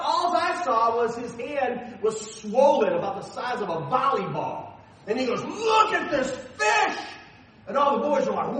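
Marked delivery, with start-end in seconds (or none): 5.4-7.0 high, slow proud holler; booming boast, stretched words; excited showing off. Retold or imitated. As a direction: show off a monster catch, drawn out. Neighbor shows off monster catch.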